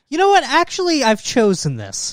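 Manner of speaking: high, bright self-assurance; mock chipper decision. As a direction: pretend it was your plan all along. Mocking friend's false bravado.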